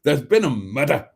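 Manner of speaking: hammed-up Glaswegian; mock-dramatic Glaswegian accent. Shown as a demonstration. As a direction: say it like a Glaswegian announcing a crime. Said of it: "murder in a Glaswegian accent".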